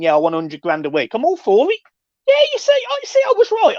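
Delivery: high, loud excited squeals; can't believe the bargain, squeal it; real delight at a cheap signing, voice shooting up; thrilled squeal. Real, not acted. Striker signed cheap, thrilled reaction.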